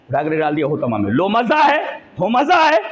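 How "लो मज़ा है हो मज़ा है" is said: high, rising jeer; mock taunt. Preacher acts out jeering at a sinner thrown into hell, mocking taunt. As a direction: taunt the condemned with raised pitch.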